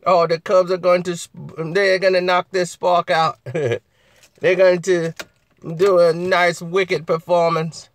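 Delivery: ordinary talk, nothing marked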